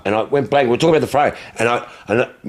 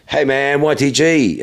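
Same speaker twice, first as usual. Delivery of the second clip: higher-pitched retold fan greeting; imitating an enthusiastic greeting; 7 semitones higher, slower than usual. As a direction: greet a bloke you recognise. Acts out fan's greeting.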